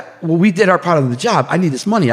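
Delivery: insistent protest. Retold or imitated. Retold argument over unpaid money.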